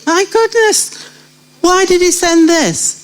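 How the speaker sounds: high, amazed exclamation; mock surprise. Retelling a puzzled reaction to an odd answer, mock surprise. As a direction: gasp in disbelief at the gift.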